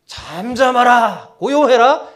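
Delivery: loud, high, drawn-out order; scolding command. Acted. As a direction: rebuke aimed at those standing behind you. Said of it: "꾸짖었을 거예요". (꾸짖듯 크고 높게 명령)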